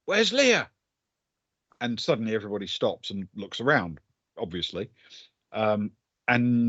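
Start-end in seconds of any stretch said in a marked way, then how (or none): 0.0-0.7 raised, searching cry; yelling out. Acted. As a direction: call out for someone missing. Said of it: "and he yells out".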